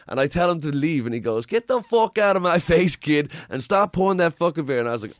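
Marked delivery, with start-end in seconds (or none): 1.5-4.8 higher and louder, a hammed-up mobster yelling; mock furious yell. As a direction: bark the kid out of the bar. Boss yelling at the kid.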